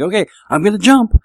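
loud, high, rising resolve; acted brave announcement before a leap; psyching himself up. Child on the high dive, psyching himself up.

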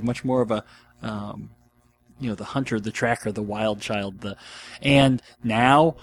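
ordinary delivery

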